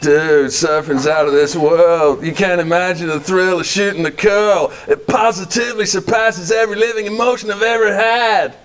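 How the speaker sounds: slow surfer-dude drawl; laid-back California surfer bragging; lightly voiced surfer accent.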